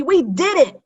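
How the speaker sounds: high, loud cheer of triumph; a kid cheering the team's success; triumphant. A narrator's reading. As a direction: cheer it like you just won. Kids cheering finished project.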